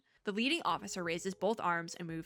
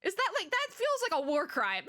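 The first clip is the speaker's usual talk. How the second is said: high-pitched exasperated protest; 10 semitones higher than usual. Real, not acted. Pleading for elders at funeral.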